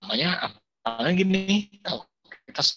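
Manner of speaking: choppy connection, words dropping out; glitching audio. Real, not acted. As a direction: voice cutting in and out. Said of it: "suaranya putus-putus".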